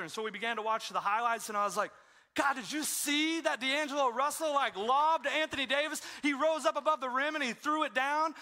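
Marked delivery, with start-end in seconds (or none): 2.3-8.3 higher, louder, thrilled recounting of the dunk; excited play-by-play. Retold or imitated. Telling God about the highlight, excitedly. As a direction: gush about the lob like a fan.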